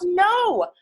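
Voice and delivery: high loud yell. Acted. Gerald finally yells at Piggy, loud. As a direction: shout it out in protest. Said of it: "finally yells".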